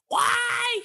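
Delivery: extremely high cartoon screech; piercingly high shouted squeal; Toad. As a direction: shriek it like a little game creature. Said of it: "does Toad still talk like".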